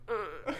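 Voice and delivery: a small choked crying sound from the throat; imitated throat cry noise. Retelling class crying, the choked throat sound. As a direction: make the sound that escapes when crying. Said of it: "I did that sound".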